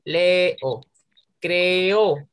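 "Leo" and "Creo" split as le-o, cre-o; measured syllabifying of "Leo", "Creo"; separated vowels. Shown as a demonstration. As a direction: give each vowel of "Leo" and "Creo" its own beat. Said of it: "las separamos".

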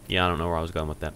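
normal talking voice

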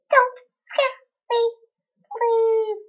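high, soft timid plea. Pretend. Shy pony begging not to be scared, high. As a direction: beg shyly not to be scared.

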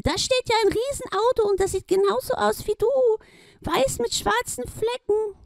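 very high duck cry. Acted. Duck spots the giant car, high cry. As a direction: call out high as the little duck.

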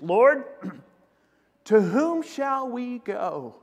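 Simon Peter. Acted. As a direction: draw out the question as an earnest appeal. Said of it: "And Simon Peter answers".

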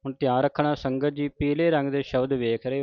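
ordinary talk, nothing marked

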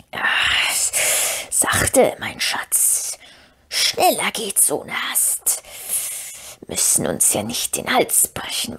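slow, whispery creature hiss; hissing, whistling Gollum. A narrator's reading. Gollum mutters to himself climbing down, hissing whistling whisper. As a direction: hiss softly to yourself, drawing out the sibilants. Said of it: "seine zischelnde und pfeifende Stimme".

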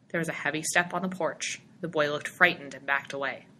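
ordinary delivery, nothing marked

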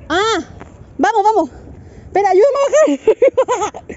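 playful reenactment of being scared to climb down; mock panicked plea. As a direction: ham up the moment you got stuck. Reenacting her fall for viewers.

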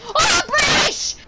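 very high, loud, drawn-out yell; hammed-up high proclamation; mock outraged shout. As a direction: shriek the nationality as a joke. Mock outcry after correcting self.

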